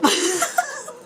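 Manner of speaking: high burst of laughter; laughing. Real, not acted. Reader cracking up at her own cheeky quote, laughing. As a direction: crack up at your own joke.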